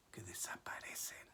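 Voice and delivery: sudden hushed whisper. Real, not acted. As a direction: drop abruptly to a whisper. Sudden drop after climax.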